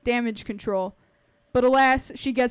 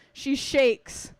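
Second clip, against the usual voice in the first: usual speaking voice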